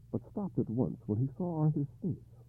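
usual speaking voice

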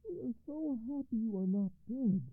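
high, relieved cry; general crying out. A narrator's reading. General relieved his mother lives, high cry. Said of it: "cried the general".

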